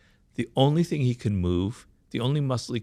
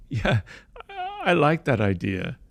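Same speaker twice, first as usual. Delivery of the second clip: drawn-out, higher-pitched fake enthusiasm; feigned approval; 4 semitones higher, slower than usual. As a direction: pretend to like it, just to please. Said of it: "They're saying that to please you".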